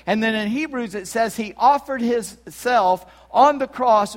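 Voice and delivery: full-force pulpit proclamation; impassioned preaching. Real, not acted. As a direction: preach with mounting force, driving every phrase.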